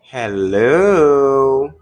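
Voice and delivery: long, loud, swooping hello; drawn-out call into the void. Real, not acted. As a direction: stretch out a loud call to an absent listener. Calling to silent caller.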